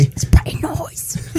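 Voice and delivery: slow, creepy whisper in mock British. Teasing the cohost with a creepy breathy British whisper. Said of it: "that's creepy".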